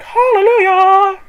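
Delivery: high, loud, stretched-out hallelujah; relieved cry of joy; joyful hallelujah. Retold or imitated. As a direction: belt it like a gospel shout. Bank fixed the card.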